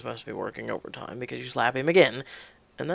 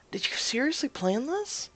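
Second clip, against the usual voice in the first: high-pitched disbelieving question; incredulous; 9 semitones higher than usual. Acted. Woman confronts partner about planning this, incredulous. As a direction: demand to know, incredulously.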